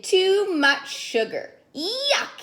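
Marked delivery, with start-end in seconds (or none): 1.7-2.4 high disgusted yuck. Acted. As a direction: spit out the taste. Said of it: "he said".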